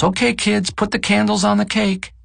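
high-pitched mock yell to the kids; imitated wife calling children. A narrator's reading. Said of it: "Marguerite yells".

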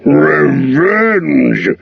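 dragon scoffs the word Revenge!; Smaug snorting. A narrator's reading. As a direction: sneer it out like a mocking dragon. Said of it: "he snorted".

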